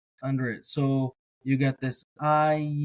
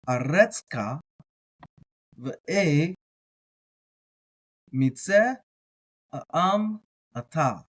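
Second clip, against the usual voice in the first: slow, careful Hebrew reading; deliberate, drawn-out learner's read-through; word by word; slower than usual. Shown as a demonstration. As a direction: read the Hebrew one word at a time, with pauses. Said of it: "we went word by word".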